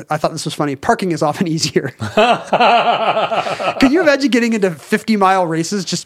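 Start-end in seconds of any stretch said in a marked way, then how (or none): 2.0-6.1 laughing. Real, not acted. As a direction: laugh through the words. Laughing at the note.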